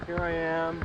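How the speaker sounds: ordinary talk, nothing marked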